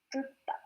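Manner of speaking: "Titta" with a doubled t; demonstrated pronunciation. Shown as a demonstration. Teacher showing the doubled consonant rule, double-t pronunciation. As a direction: hold the t in "Titta" before releasing it. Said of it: "It sounds".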